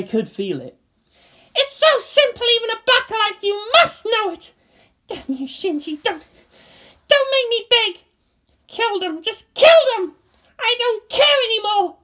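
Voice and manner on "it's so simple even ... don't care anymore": high, loud, breaking pleas; a girl screaming in despair; desperate furious girl. Acted. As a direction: beg and rage at once. Asuka begging in tears.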